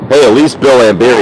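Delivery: normal talking voice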